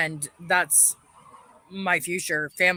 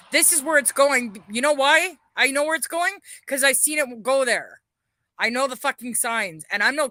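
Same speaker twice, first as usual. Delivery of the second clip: raised, higher, louder furious voice; furious rant; 6 semitones higher, 4 dB louder than usual. Real, not acted. Ranting live to viewers about the police, shouting in fury. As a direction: shout your anger at the cops who failed you.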